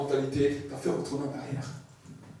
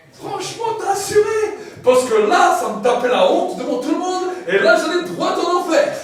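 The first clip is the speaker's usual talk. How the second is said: loud, high relieved gush; relieved thanks; 13 semitones higher, 12 dB louder than usual. Acted. Believer thanks Jesus in heaven, excited and relieved. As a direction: blurt out grateful relief.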